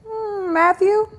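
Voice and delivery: high, slow, questioning Matthew; mock-suspicious high hmm, Matthew?; suspicious sing-song. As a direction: raise the pitch and drag out Matthew with suspicion. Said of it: "And I said".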